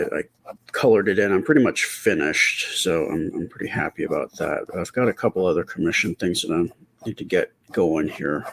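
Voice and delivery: ordinary talk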